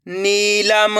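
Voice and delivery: नीलम with नी held long. Shown as a demonstration. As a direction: stretch the ee in नीलम. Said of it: "मेरे साथ साथ इनको repeat करना है".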